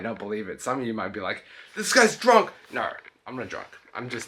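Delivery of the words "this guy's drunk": high-pitched imitation of a doubting viewer; mock a viewer's accusation, pitched up; higher, exclaimed mock accusation; accusing viewer.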